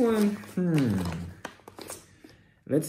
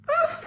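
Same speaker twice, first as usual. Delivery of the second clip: sudden very high excited yelp; short thrilled shriek; high squeal of delight; 3 dB louder than usual. Real, not acted. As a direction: squeal like the reveal just hit you. Crisp wrap revealed.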